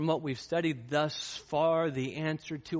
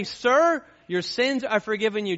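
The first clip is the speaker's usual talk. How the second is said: louder, higher formal pronouncement; preacher booming the cold version; formal loud address; 7 semitones higher, 8 dB louder than usual. Shown as a demonstration. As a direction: call him out formally as a stranger. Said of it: "he didn't say".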